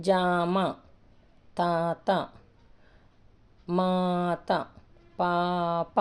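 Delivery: "జామ", "తాత", "మాత", "పాప" with held first vowels; long-vowel demonstration. Shown as a demonstration. Teacher modelling word reading, stretched "తాత" and "పాప". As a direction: draw out each first syllable, as in "జామ". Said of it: "ఈ విధంగా ఉంటుంది".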